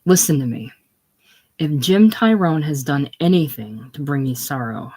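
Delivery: normal talking voice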